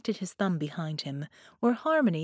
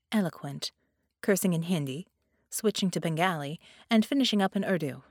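ordinary delivery

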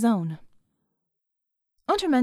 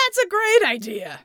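put-on higher, louder drunk shout; 8 semitones higher, 3 dB louder than usual. A narrator's reading. Drunk Red agreeing with John, a mock shout. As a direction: cheer it like a happy drunk. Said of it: "Red shouted".

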